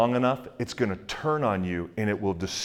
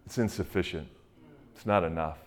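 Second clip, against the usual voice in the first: soft, much quieter mock doubter; hushed doubting; 6 semitones lower, 9 dB quieter than usual. Preacher voicing a doubter before the cross, hushed doubt. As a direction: murmur it like a small, unbelieving voice.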